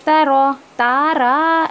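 ता रा drawn out slowly with a light रा; wrong reading. Shown as a demonstration. Teacher shows the mistake in ता रा. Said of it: "ऐसा नहीं पढ़ने का".

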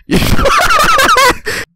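very high squealing laughter; long high cackle; hysterical laugh. Real, not acted. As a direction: lose it laughing, shrieking. Bursts out laughing at the reading.

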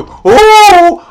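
high loud delighted ooh; excited ooh. Real, not acted. Reacting to own shot off glass, excited. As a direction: gasp it out impressed.

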